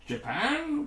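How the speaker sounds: sharp, high worried query; a touch of worry, pitched high; worried question. A narrator's reading. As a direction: ask it with rising alarm. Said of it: "queried Dylan, worriedly".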